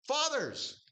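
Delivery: very high, ringing address to fathers; calling out. Acted. Preacher calls out the next addressee.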